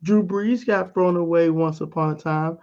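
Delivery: ordinary delivery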